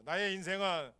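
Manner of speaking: loud fervent preacher's cry; preaching at full force. Acted. Preacher shouting to the congregation, fervent. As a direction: call it out to the congregation.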